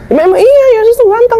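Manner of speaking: high-pitched, emphatic comeback; insistent retort. Retold or imitated. Believer defending Jesus' picture to opponent, insistent retort.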